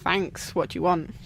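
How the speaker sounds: low, grumpy neighbour voice; a lightly voiced suspicious neighbour; nosy neighbour.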